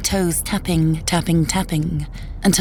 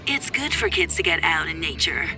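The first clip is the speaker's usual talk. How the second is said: softer, filtered ex-husband on the phone; tinny phone voice; 5 dB quieter than usual. Acted. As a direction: argue down a thin phone line. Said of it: "a tinny voice coming from my phone".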